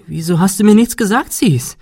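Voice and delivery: upset outburst, pitched high; voice cracking with agitation. A narrator's reading. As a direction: let agitation push the voice up to breaking. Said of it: "Ihre Stimme überschlug sich vor Aufregung".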